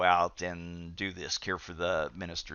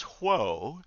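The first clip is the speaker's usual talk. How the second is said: half-hearted mock yell, higher, dipping then rising; retold yell; 5 semitones higher than usual. Minister riding up to his congregation, mock yell. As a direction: imitate a rider's halt call, lightly. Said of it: "he yells".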